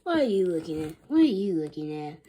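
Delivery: loud angry snarl. Acted. Principal pushing past staring staff, loud snarl. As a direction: snarl it at the onlookers. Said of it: "Mister Crow snarled".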